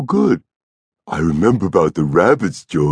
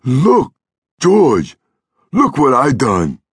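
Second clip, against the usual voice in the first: high, loud, delighted outburst; childlike excitement; 10 semitones higher, 5 dB louder, slower than usual. A narrator's reading. Lennie showing George his ripples, excited.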